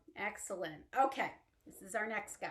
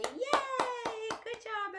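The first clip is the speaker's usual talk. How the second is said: excited cheer; 11 semitones higher, much slower than usual. Acted. Praising children after song.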